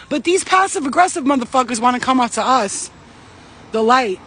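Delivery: ordinary talk, nothing marked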